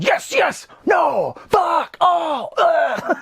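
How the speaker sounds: high-pitched, hammed-up yelps; mock frantic outbursts. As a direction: imitate an over-excited movie hacker. Acting out frantic hacking scene.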